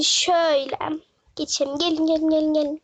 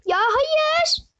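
much higher wailing no; whiny protest; 10 semitones higher than usual. Real, not acted. Protesting the punishment.

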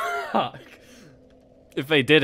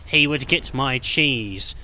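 normal talking voice

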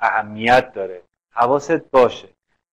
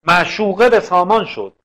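measured verse reading; poem recitation; 4 semitones higher, 5 dB louder than usual. Shown as a demonstration. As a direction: recite the verse slowly, lifting the first line. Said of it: "مثل این بیت".

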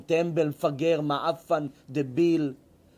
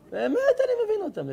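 laughing; 12 semitones higher than usual. Real, not acted. Laughs while agreeing.

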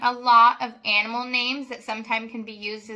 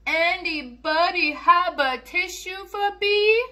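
a lightly higher, pleading little flea; sick little flea; 7 semitones higher, 5 dB louder than usual. Acted. The sick flea begging the farm for a tissue, small and pleading. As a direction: plead like a stuffy tiny bug.

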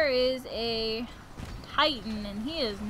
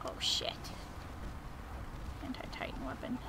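whisper; 13 dB quieter than usual. Real, not acted. Spotting a threat.

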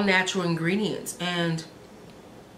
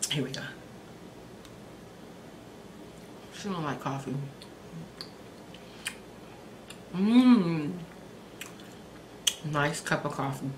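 speaking between bites; chewing while talking; 3 semitones lower, 9 dB quieter than usual. Real, not acted. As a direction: mouth partly full of cookie. Seen 2: Bites macaron at -4, holds piece, chews with closed lips throughout.

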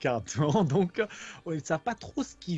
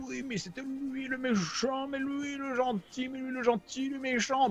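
higher, soft mock confusion; confused hero voice; 6 semitones higher, 5 dB quieter than usual. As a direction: voice the muddled heroes. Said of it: "en vous disant".

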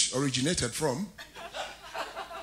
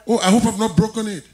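usual speaking voice

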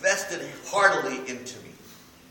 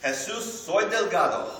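higher and louder, with a rising Jesus; playful declaration; 6 semitones higher, 5 dB louder than usual. Shown as a demonstration. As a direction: announce it boldly in Spanish. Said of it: "I would say this to Jesus".

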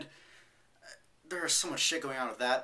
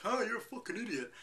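mocking know-it-all; 9 semitones higher than usual. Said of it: "someone else is like".

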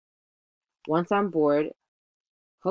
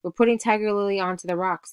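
higher-pitched call across water; mild call, pitched up; 6 semitones higher, 3 dB louder than usual. A narrator's reading. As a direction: report it out to the captain. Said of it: "Smee called".

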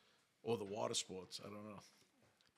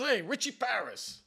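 neighbour introduces himself; 12 semitones higher, 11 dB louder than usual. Retold or imitated.

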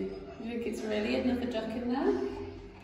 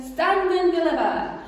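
much higher, much louder robber cry; thundering rat; 9 semitones higher, 10 dB louder than usual. Acted. Rat stopping the squirrel, thundering. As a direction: thunder the command. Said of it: "who thundered".

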